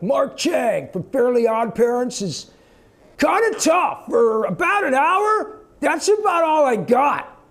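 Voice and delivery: very high, loud, slow alien screech; Mark Chang voice. Acted. Showing fans the voice that is hard on him, high loud strain. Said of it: "onerous on my voice".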